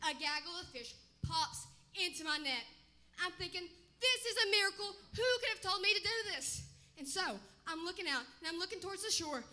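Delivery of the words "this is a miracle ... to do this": amazed. Acted. Peter acting his thoughts, amazed.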